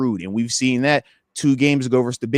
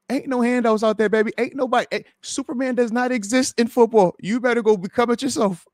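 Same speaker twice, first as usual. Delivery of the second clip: higher-pitched, mock incredulous scolding; voicing players setting fans straight, pitch raised; exasperated retort; 8 semitones higher than usual. Player answers begging fans, exasperated retort.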